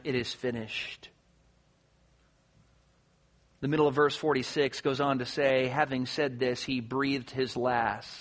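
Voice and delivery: low, soft, solemn delivery; hushed preaching. Real, not acted. Preacher recounting Jesus choosing to die, hushed and solemn. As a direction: drop right down and tell it reverently.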